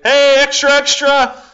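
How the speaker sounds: high, loud street-seller call; calling out to passing crowds; newsboy yell. Retold or imitated. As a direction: shout it like a paperboy hawking papers. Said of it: "trying to sell newspapers and you're like".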